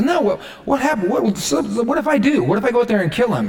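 high-pitched, tumbling eagerness; eager protest. Acted. Young David answering those who make fun of him, eager. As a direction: stumble into a high, insistent protest.

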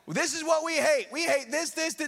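high-pitched mock ranting; worked-up caricature of protest; mock hateful church. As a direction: imitate people railing at what they oppose. Mimics a church that hates.

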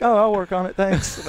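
pitched well up, overly gracious thanks; lightly voiced humble driver; high, polite mock reply. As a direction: sound sweetly humble to the kid.

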